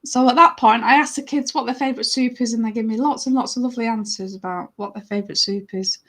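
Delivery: plain reading voice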